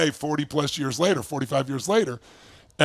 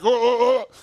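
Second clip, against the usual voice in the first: higher, flustered string of oh's; apologetic backpedalling; 5 semitones higher, 4 dB louder than usual. Retold or imitated. Man caught out by the friend's story, apologetic. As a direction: imitate someone hastily backing off. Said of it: "He was all apologetic then".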